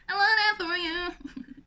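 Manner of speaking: high, loud put-on gush; playful loud repeat. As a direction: belt it out in a silly high voice.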